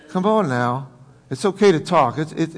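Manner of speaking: normal talking voice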